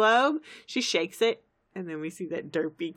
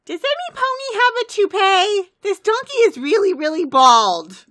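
very high, loud cartoon pony shout; full-blast, high-pitched mock-alarmed yell; 13 semitones higher, 11 dB louder than usual. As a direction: holler it at the top of your voice. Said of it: "So then she starts yelling".

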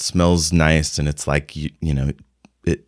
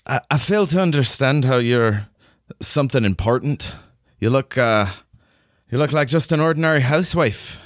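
higher-pitched, doubtful officer with a light Scottish lilt; skeptical Scottish commander; 8 semitones higher, faster than usual. Acted. Game master as Merida doubting the housewife, skeptical character voice. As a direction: size up the newcomer, unimpressed, in a put-on voice.

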